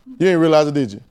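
ordinary delivery

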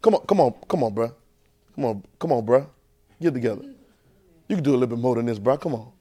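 low, soft, laid-back coaxing; God nudging like a buddy; God urging the preacher, low and soft; casual God voice. Acted. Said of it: "That's how he said it".

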